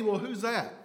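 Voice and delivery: ordinary talk, nothing marked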